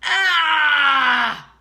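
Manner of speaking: high, loud compressed shout of ah. Shown as a demonstration. Coach demonstrates vocal compression, shouted. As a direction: squeeze and shout it. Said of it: "compression at its finest".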